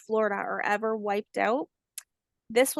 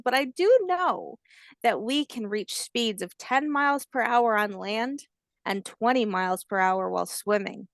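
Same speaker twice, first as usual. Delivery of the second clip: plain reading voice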